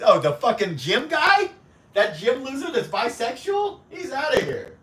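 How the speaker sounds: high, loud outburst banning the gym guy; mock outrage. Real, not acted. Streamer to chat, kicking out a troll, loud and high. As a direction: shout him out of the chat in mock fury.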